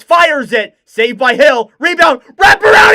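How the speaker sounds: rising from call to full shout; building goal call. Real, not acted. Commentator calling the rebound, rising to a shout. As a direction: let the play build into a roar.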